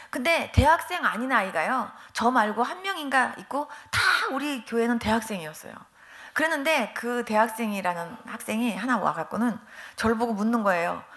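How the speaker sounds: usual speaking voice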